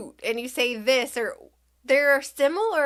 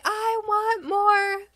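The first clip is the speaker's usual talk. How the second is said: put-on high, drawn-out child's demand; imitating angry toddler; 9 semitones higher than usual. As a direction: mimic a mad little kid, pitched way up. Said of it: "she's getting mad and madder and just angry".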